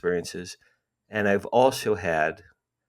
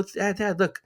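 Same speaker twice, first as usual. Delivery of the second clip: put-on high, eager boy tugging at dad; thrilled kid; 11 semitones higher than usual. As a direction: call dad to look, excited. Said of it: "I was so thrilled".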